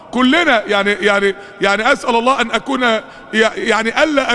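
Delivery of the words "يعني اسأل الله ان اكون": ordinary talk, nothing marked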